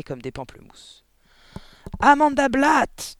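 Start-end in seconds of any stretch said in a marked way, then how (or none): 2.0-3.2 booming drawn-out high bellow; thundering. A narrator's reading. Headmistress summons a pupil across the playground, thundering. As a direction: roar the name like thunder. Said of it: "tonna-t-elle".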